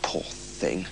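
soft sorry whisper to herself; hushed regretful whisper; whispered pity. Acted. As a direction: breathe it out, no voice. Beryl to herself.

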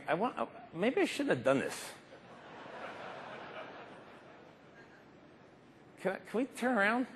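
high, soft and hesitant, losing nerve; a timid voice backing out mid-air; nervous second thoughts. Pretend.

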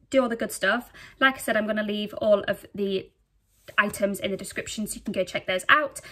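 normal talking voice

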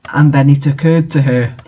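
usual speaking voice

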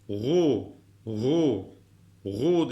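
"Gu" with a heavy g from the throat; thick throaty "Gu". Shown as a demonstration. Teacher models dotted throat letter, thick throaty "Gu". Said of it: "O da boğaz harflerinden".

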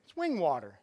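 wing water with the L dropped, said as "wing"; imitating a child saying "wing water" instead of living water; child's mispronunciation. Shown as a demonstration. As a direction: say "wing water" the way a little kid does. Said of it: "He calls living water wing water".

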